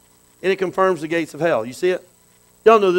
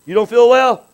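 loud, high hearty challenge; brash parent barging in; 4 semitones higher, 10 dB louder, slower than usual. Acted. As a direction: burst in on the sick child, loudly. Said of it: "walk in the room and say".

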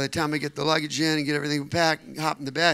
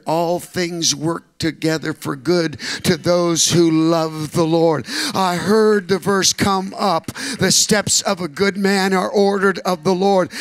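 slow, louder, weighty verse quoting; solemn scripture recital; 8 dB louder, slower than usual. Acted. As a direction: lay each word down slowly like scripture. Recalls verses in church.

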